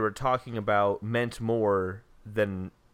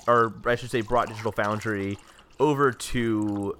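usual speaking voice

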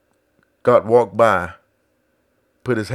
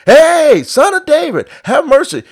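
high, loud desperate cry for mercy; blind man screaming; 11 dB louder than usual. Retold or imitated. Blind man calling to passing Jesus, loud desperate cry. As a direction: cry out to be heard over the crowd. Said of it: "the blind man was screaming".

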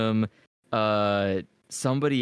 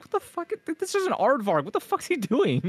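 mock confused kid.

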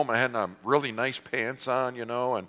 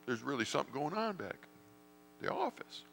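hushed aside; 6 semitones higher, 12 dB quieter than usual. Retold or imitated.

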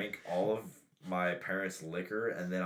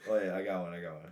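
ordinary delivery

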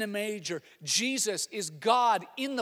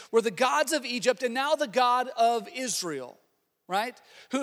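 ordinary delivery

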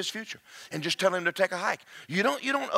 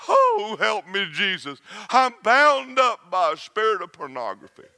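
loud mock wail to Jesus; mock desperate plea; hammed-up cry for help; 3 semitones higher, 6 dB louder than usual. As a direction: overplay the panic. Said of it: "you'll go".

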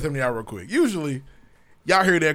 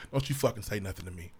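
low, quiet menace; cold hostile mutter; 7 semitones lower, 12 dB quieter than usual. Retold or imitated. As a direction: mutter it like a keep-away warning. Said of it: "Unapproachable is".

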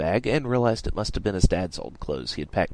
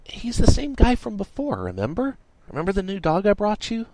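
put-on high-pitched girl voice, reminding; Miranda; 13 semitones higher, 4 dB louder than usual. A narrator's reading. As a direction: explain in a high, pleading girl voice.

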